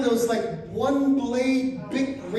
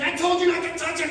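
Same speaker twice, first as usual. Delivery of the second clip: higher, fast scolding; mock-angry nurse; pissed nurse; 7 semitones higher, 3 dB louder, faster than usual. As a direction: snap it at him like an irritated nurse. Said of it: "she's like".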